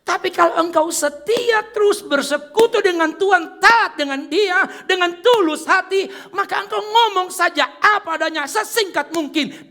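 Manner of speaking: high, loud preacher's cry; full-force preaching. Acted. Pastor to congregation about the spider, loud high preaching. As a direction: lift the hall with the point.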